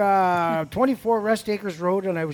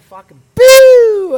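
sudden loud high siren yelp, slowed; mock siren boo; 13 semitones higher, 18 dB louder, much slower than usual. Cop sirens behind driver, loud mock siren. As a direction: blast it like a police siren.

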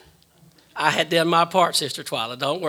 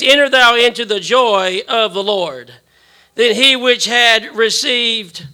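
booming biblical narration; 4 semitones higher, 7 dB louder, slower than usual. Acted. Pastor reads scripture aloud.

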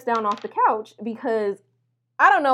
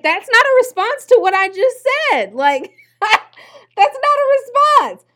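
very high, loud incredulous shout, slowing down; a high, loud shriek scolding the date for dodging the question; exasperated shriek; 11 dB louder, slower than usual. Retold or imitated. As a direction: screech in disbelief at his non-answer. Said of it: "And I'm like".

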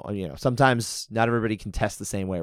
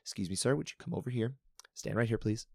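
softer, calm and courteous request; calm polite; 9 dB quieter than usual. Retold or imitated. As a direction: ask politely, no edge at all. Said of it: "calmly say".

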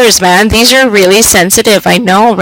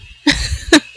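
breathy laugh; 6 semitones higher, 7 dB quieter than usual. Real, not acted. Mic too loud, laughs.

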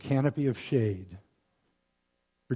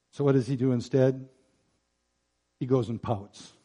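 normal talking voice

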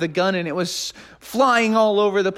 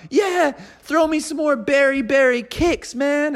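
higher laid-back dude calling out; shouted request; 9 semitones higher than usual. Acted. Partygoer shouting to Froggy for more cereal, higher dude voice. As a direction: call out like a party guy wanting more. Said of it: "somebody shouted out".

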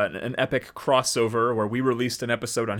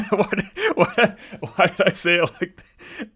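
real laughter through the words, higher and louder; laughing; 9 semitones higher, 6 dB louder than usual. Real, not acted. Host laughing at how he read the verse, breaking up. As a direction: crack up at your own slip. Cracks up at own odd reading.

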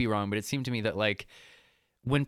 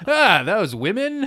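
put-on Boston accent, high, loud and slow; lazy put-on Boston accent for Michael; mock Boston accent; 11 semitones higher, 10 dB louder, slower than usual. Michael waving off women in a put-on Boston accent. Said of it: "why he has a Boston accent".

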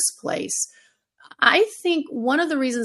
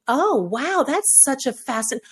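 high, gushing mock amazement; patronizing wow; condescending surprise; 6 semitones higher than usual. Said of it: "it was very much the".

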